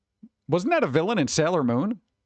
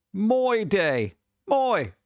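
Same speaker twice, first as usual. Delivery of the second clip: mock Aussie accent; 5 semitones higher, much slower than usual. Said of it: "That's right, mate".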